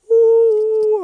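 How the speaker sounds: high, loud drawn "ooh"; excited ooh. Real, not acted. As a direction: cry out, fired up by your own words. Hyped by his own point.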